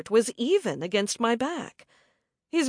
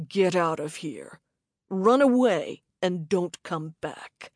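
menacing stranger; 4 semitones lower than usual. A narrator's reading. Attacker orders her away.

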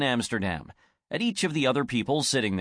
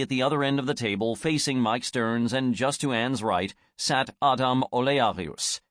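ordinary delivery, nothing marked

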